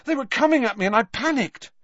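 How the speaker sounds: put-on high, fast, breathless boy; panicked panting. A narrator's reading. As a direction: blurt it out, still shaken. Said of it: "panted Harry".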